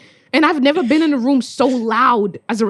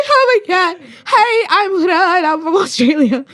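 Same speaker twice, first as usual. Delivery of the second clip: higher, nervous, breathless; nervous rush; 6 semitones higher than usual. Retold or imitated. Meeting the auntie organizer, nervous and rushed. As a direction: blurt it out nervously. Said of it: "I was like".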